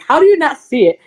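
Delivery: high, loud exasperated disbelief; incredulous retold argument. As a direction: call him out in disbelief. Arguing with driver about deer.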